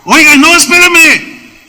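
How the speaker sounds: high, loud protest, slowing on espérame; hammed-up plea to wait; high mock protest; mock alarm. Mocking an overeager singer.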